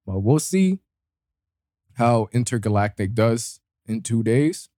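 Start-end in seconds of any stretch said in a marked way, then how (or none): none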